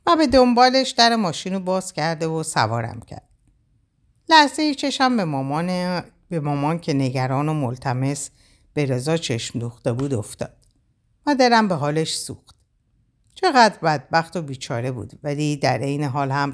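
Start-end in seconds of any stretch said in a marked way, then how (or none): none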